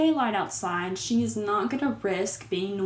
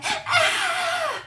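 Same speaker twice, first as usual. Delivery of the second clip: mock shocked scream. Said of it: "The lady is just like".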